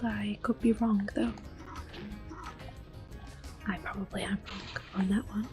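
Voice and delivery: ordinary talk, nothing marked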